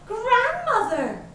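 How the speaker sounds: put-on high, astonished little-girl voice; startled high exclamation; gasped. A narrator's reading. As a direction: cry it out high in shock. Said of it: "she gasped".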